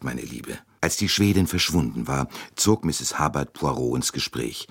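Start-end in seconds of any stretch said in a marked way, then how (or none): 0.0-0.6 meine Liebe dropped low and much softer as a goodnight; a soft, lowered meine Liebe trailing into goodnight; softer closing endearment. Acted. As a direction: let meine Liebe fall away quietly. Mrs Hubbard voiced by narrator.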